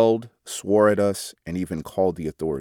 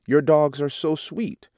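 high, sweet sing-song greeting; greeting the angry neighbor in a high, friendly sing-song; 8 semitones higher than usual. Retold or imitated. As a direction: sing it out warmly to a wary neighbor. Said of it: "I sang out in a very friendly voice".

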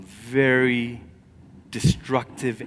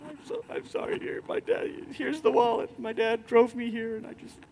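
put-on much higher, soft, frightened child apologising; a beaten boy pleading sorry to the neighbour; trembling boy; 10 semitones higher, 6 dB quieter than usual. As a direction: voice a scared, shaking boy returning the wallet. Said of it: "He's like".

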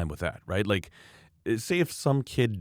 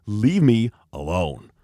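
slow, louder, emphatic order; firm demand; 5 dB louder, much slower than usual. Retold or imitated. Skier telling off the angry mother, slow and forceful. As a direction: space it out as a final warning. Retold climax of confrontation.

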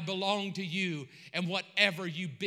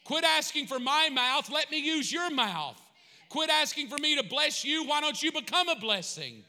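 preaching as Jesus; 4 semitones higher, 5 dB louder than usual. Acted. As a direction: let Jesus call the church to serve. Preacher voicing Jesus, full force.

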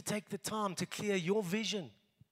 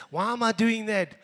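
normal talking voice

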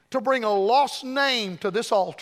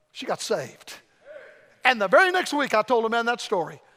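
ordinary talk, nothing marked

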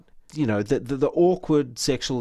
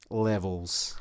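quoting Kramer; 5 semitones lower, 6 dB quieter than usual. Retold or imitated. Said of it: "as Matt Damon and Cosmo Kramer once said".

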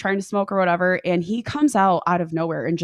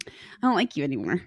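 whispered thought; 4 semitones lower, 4 dB quieter, faster than usual. Retold or imitated. Inner thought at the wedding.

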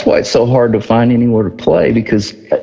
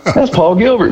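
high-pitched retold astonishment; sudden realization; 7 semitones higher than usual. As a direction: relive the moment it dawns on you. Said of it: "And then I woke up".

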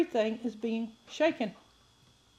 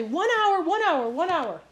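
high repeated mock reassurance; natives' promise; 5 semitones higher than usual. Preacher imitating guides promising a short hike, playful and high. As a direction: echo the guides' cheerful lie.

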